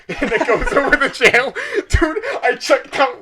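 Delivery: laughing while telling it; can't stop laughing mid-sentence. Real, not acted. Laughs at the title card.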